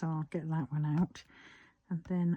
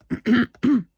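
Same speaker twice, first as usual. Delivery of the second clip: throat clearing; 7 semitones higher, 14 dB louder than usual. Real, not acted. Loud throat clearing mid-task.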